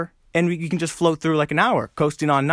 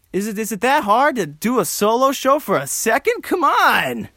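high, exasperated whine; comic frustration; 9 semitones higher, 3 dB louder than usual. Real, not acted. Solo host struggling to fill time, comic frustration. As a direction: build to a high, pleading outburst.

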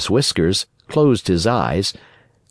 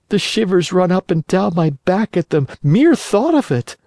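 put-on high boyish puppet voice, much higher; Pinocchio voice; 10 semitones higher, 3 dB louder than usual. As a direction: play the wooden boy, frightened and eager. Pinocchio's voice in story.

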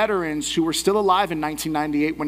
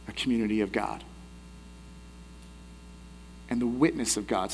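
solemn preaching; 4 semitones lower, 8 dB quieter than usual. Acted. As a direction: lay the warning down gently and gravely. Solemn closing reflection.

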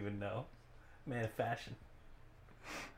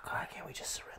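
voiceless, whispery plea to give up; whispered plea; 9 dB quieter, faster than usual. Acted. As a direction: whisper it like you want to surrender. Playing a hopeless surrender plea.

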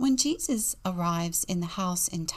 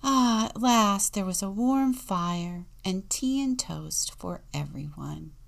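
usual speaking voice

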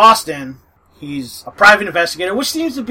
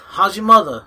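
quick put-on Boston accent; Mark Wahlberg Boston accent; 4 semitones higher than usual. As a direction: toss off a Boston line on request. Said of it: "Just pretend you're Mark Wahlberg".

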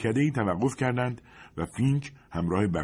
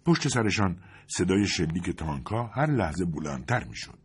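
ordinary delivery

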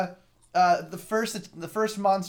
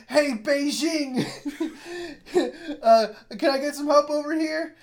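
lightly voiced boy, higher, with laughs breaking through; bratty kid taunt; 6 semitones higher, 5 dB louder than usual. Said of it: "and he goes".

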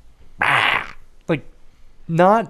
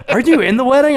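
put-on girlfriend's question; 12 semitones higher, 10 dB louder than usual. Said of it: "She was like".

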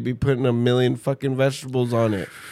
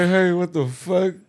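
mock outburst; 8 semitones higher than usual. Acted outburst, laughing.

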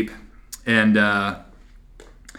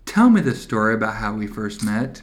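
cooing snuggly request; 4 semitones lower, 7 dB quieter than usual. Pretend. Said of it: "He goes".

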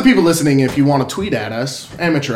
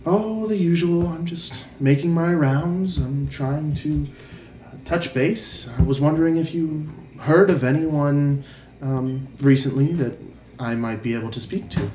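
half-elf regular; 4 dB quieter than usual. Acted. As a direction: play an unhurried visitor making quiet inquiries. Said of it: "she walks in and she says".

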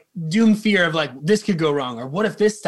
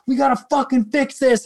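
urgent, higher-pitched imitation of a stressed bandmate; higher, anxious mock demand; 7 semitones higher than usual. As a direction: act out a drummer getting frantic. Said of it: "getting more and more anxious".

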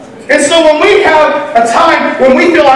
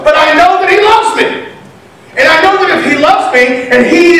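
fervent, raised preaching voice; impassioned preaching; 4 semitones higher than usual. Acted. Preacher assures the congregation of God's love, fervently. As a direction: preach it out with conviction.

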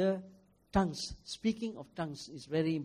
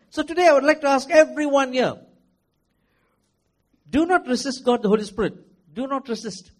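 loud, urgent pulpit exhortation; fervent preacher's appeal; preaching at full force; 5 semitones higher, 10 dB louder than usual. Acted. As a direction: drive it home to the congregation.

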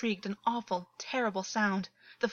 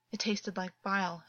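ordinary delivery, nothing marked

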